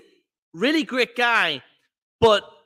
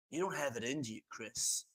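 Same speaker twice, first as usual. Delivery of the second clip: 1 quiet quote; 6 semitones lower, 15 dB quieter than usual. Retold or imitated. Quoting Pat, hushed.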